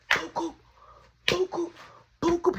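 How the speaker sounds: high, short "boop" punches; imitated punch sounds. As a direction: punch out the hits in high little boops. Acting out punches in a fight.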